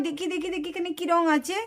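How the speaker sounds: soft, searching mutter; muttering to herself. Acted. Child hunting for a colour, murmuring softly to herself. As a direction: murmur it while looking through the colours.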